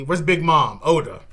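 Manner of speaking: loud mock outrage; where is she, loud and indignant; demanding shout. Real, not acted. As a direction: yell it at the author. Demanding Big Mom appear.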